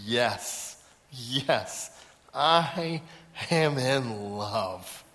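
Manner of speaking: slow, dreamy mock declaration; low, savoring each word; lovestruck. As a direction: draw it out like a blissful lovesick boy. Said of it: "He's really happy".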